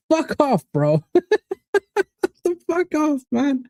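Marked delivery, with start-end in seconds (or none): 1.1-2.4 sudden high laugh; high burst of laughter. Real, not acted. Laughing at spoiled City fans complaining. 2.4-3.6 high, half-laughing fuck off; laughing dismissal. Real, not acted. Laughing off City fans' complaints.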